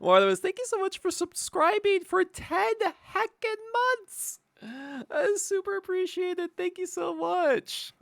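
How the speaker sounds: high sing-song thanks; high thank-you voice. Acted. Thanking a subscriber.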